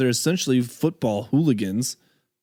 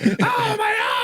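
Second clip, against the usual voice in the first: hammed-up cry of a diving soccer player; mock injured flopper. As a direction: ham up a faked injury. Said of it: "pretending like".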